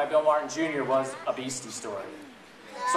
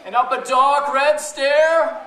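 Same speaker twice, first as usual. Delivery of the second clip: lively, sing-song storybook reading; reading to children; 10 semitones higher, 6 dB louder, much slower than usual. Acted. Mother reading a beastie story to her children, sing-song and lively. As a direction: read it big and playful for the kids. Reads picture book aloud to kids.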